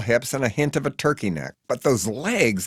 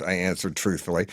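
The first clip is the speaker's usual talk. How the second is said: usual speaking voice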